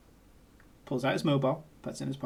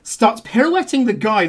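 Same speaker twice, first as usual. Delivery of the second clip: normal talking voice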